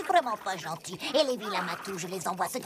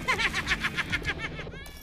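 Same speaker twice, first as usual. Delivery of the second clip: villain laugh; 5 semitones higher than usual. Acted. Thief flies off gloating.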